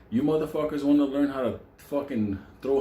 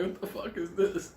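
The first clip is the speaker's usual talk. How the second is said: high, softer comic incredulity; mock disbelief; 9 semitones higher, 5 dB quieter than usual. Storyteller faces a kitchen without coffee, mock disbelief. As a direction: squeak out baffled outrage.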